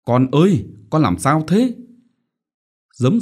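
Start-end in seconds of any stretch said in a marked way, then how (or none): 0.0-1.7 higher, louder anxious call; worried mother. A narrator's reading. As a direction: call out to the daughter. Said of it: "me lay cô". (mẹ lo lắng gọi con)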